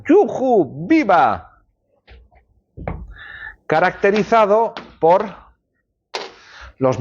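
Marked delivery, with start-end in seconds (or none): 0.1-1.6 lightly acted whoops of delight; cheerful exclamations. Shown as a demonstration. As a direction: demo of happy cheering. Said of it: "expresar emociones".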